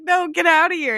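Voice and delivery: high, pushy; mock shout. Quoting Rita shooing Megan out, high mock shout. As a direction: shove the words out. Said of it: "it's like".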